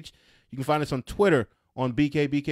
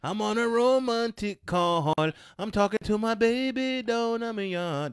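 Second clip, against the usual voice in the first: high, mock Jamaican accent; hammed-up Cool Runnings Jamaican accent; 8 semitones higher than usual. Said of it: "I got the one right from Cool Runnings".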